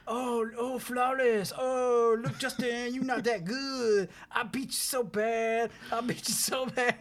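high, slow gloating tease; gloating taunt. Retold or imitated. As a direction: rub it in, drawn out and smug. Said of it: "and I was like".

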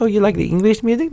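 high, quick, eager question; lightly voiced sweet lady asking; sweet older woman, imitated. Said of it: "and she's like".

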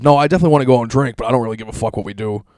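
quick retold friends. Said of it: "everyone just says".

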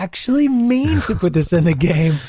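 high, fake-sorry company voice; mock them pleading innocence; mock apology. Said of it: "backtracked and said".

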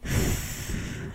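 long, breathy sigh; deep sigh. Real, not acted. Guest asked what the party should do next, deep sigh. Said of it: "Deep sigh".

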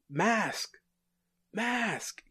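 high-pitched calling out, held back in volume; mock yell. Torch calls to a fallen god who won't answer, mock yelling. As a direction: call the name out high, as if yelling. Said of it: "And so he yells out".

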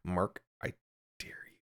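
hushed dare; whisper. Pretend. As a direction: whisper it menacingly.

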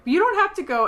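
high, loud pleading. Retold or imitated. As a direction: beg him to stay. Eleanor pleading with Chidi.

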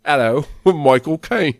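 loud, high, slow mock Cockney; Michael Caine impression. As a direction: belt out a hammy Cockney hello. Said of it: "if you say it like he does".

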